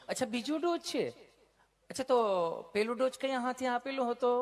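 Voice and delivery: high, sweet nurse voice; mock sugary nurse voice. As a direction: coo it like the kind nurse.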